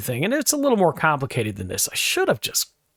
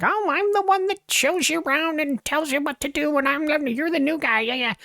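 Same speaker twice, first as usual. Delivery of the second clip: higher mocking coworker voice; mock bossy coworker; 12 semitones higher than usual. Coworker telling the new guy she shows him around, mock high voice. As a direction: lift into a high, smug imitation.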